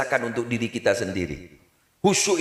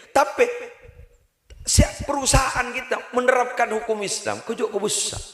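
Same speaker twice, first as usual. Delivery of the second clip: preaching at full force; 9 semitones higher than usual. Acted. Preacher rousing the congregation, fervent high shout. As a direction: drive the point home to the congregation.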